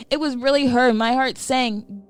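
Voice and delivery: plain reading voice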